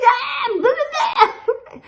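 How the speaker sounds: imitated wailing blah blah; mock screaming babble. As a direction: ham up a man shrieking in pain.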